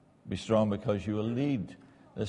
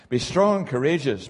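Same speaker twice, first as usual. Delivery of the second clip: louder proclaimed verse; forceful scripture reading; 4 semitones higher, 7 dB louder than usual. Acted. Preacher proclaiming God's charge to Joshua, forceful. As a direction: ring it out like a command.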